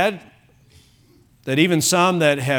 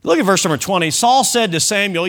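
ordinary talk, nothing marked

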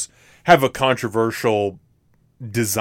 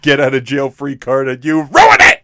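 mock outrage; 3 semitones higher, 6 dB louder than usual.

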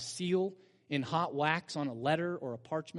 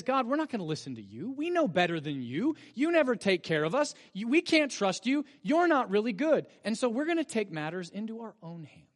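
defiant rebels; 6 semitones higher, 6 dB louder than usual. Retold or imitated. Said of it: "Who says".

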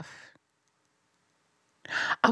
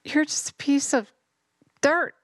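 rising demon taunt; 7 semitones higher than usual. Acted.

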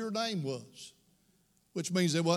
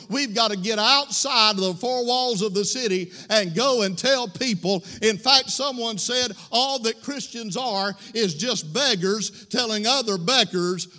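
louder, ringing sermon call to go and tell; preaching at full force; 4 dB louder than usual. Acted. Pastor urging his church to share the bread, full-force preaching. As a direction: preach it out over the organ. Preacher exhorting the congregation, music.

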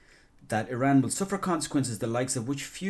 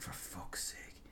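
very slow, barely voiced whisper; disappointed sigh; 17 dB quieter, much slower than usual. Podcaster reacts to dull daytime TV listings, disappointed. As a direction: breathe it out softly, utterly let down. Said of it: "I was like".

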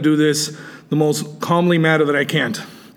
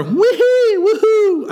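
sarcastic falsetto woo-hoo; mocking cheer; much slower than usual. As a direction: jeer with a squeaky fake celebration. Mocking rival's wrestling career.

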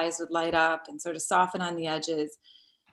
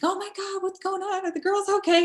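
much higher, frantic worried voice; alarmed husband; 14 semitones higher than usual. Retold or imitated. Husband bursting in, alarmed at finding her crying. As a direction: imitate her husband panicking over her. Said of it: "And Michael came in and was like".

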